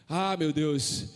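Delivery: ordinary talk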